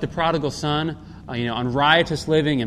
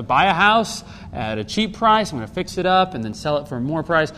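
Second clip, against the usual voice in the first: ordinary talk, nothing marked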